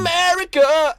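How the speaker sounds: high, loud mock American cry; over-the-top American shout; American accent. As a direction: belt it out in a hammed-up American accent. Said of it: "what about American?".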